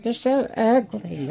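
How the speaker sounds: high, slow mock wife voice; imitating wife. Imitating his wife calling bulldogs ugly, high and slow. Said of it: "She's like".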